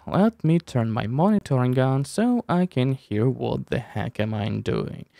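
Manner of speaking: ordinary talk, nothing marked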